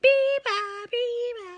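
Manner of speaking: high chirpy bird call; high mimicked birdsong; bird call imitation. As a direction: sing it like a little bird. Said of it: "they always sound like they're saying".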